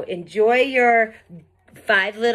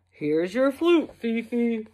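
fish's voice; 3 semitones higher, 3 dB quieter than usual. Acted. Fish returns the flute.

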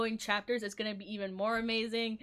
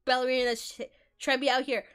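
frantic; 3 semitones higher, 9 dB louder than usual. Real, not acted. Frantic boss fight.

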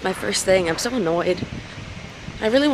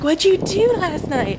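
high, slow questioning friend; 9 semitones higher, slower than usual. Retold or imitated. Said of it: "She was like".

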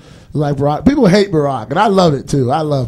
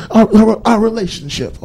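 mock girlfriend voice; 8 semitones higher, 3 dB louder than usual. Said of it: "girlfriend voiced in argument".

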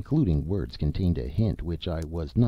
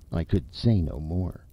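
plain reading voice

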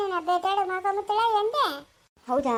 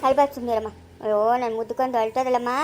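soothing; 8 semitones lower than usual. Real, not acted. Grandmother soothing a crying child.

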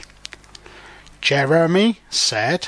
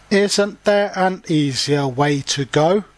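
plain reading voice

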